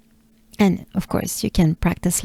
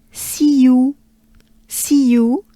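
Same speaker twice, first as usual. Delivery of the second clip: "see you" with a French accent; higher, separated French-accented "see you"; 5 semitones higher than usual. Shown as a demonstration. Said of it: "But with a French accent".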